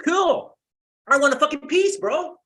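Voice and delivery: high, loud sarcastic cheer; mock eager sarcasm. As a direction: play along mockingly, demanding a cut. Sarcastic rant about sharing loot.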